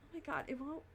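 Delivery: strained, about to cough; holding back a cough. Real, not acted. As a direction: fight the urge to cough. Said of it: "I'm about to cough again".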